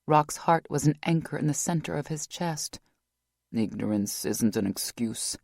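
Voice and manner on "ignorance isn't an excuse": deep, sad male voice; lower, flat with guilt; Dunn, low and grim. A narrator's reading.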